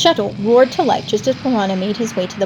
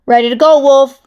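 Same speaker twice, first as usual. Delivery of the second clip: calling out; 4 semitones higher, 8 dB louder than usual. Acted. Piranha over the comm.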